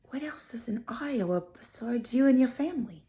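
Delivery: a sly aside, all breath and no voice; mocking whisper. Teasing an Iowan, whispered snark. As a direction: whisper the snarky question under your breath. Mock aside about Iowa.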